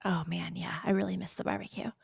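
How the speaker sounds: soft whisper of missing home food; whispered longing. Real, not acted. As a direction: whisper it like a guilty confession. Confessing longing for Texas barbecue.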